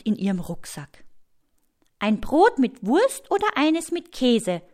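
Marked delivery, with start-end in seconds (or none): 2.0-4.6 put-on young girl asking. Story figure Mina asking.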